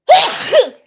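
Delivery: sudden loud, high-pitched sneeze; real sneeze. Real, not acted. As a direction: sneeze explosively. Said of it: "I feel it coming".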